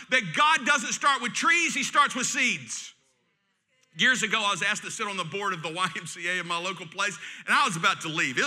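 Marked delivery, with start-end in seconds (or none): 0.1-2.9 slow, louder punchline; emphatic preaching. Acted. Pastor stating the sermon theme, slow and emphatic. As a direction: land each word firmly.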